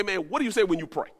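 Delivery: quick, worked-up retelling of a friend's question; riled-up fast talk. Retold or imitated. Friend riled up, challenging the guy, fast and worked-up. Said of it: "when he kinda get it riled up".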